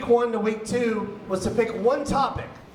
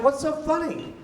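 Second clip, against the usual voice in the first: high-pitched, bewildered question; baffled questioning; 7 semitones higher than usual. Retold or imitated. Teacher asking his laughing student, baffled questioning. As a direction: ask it higher, as a baffled teacher.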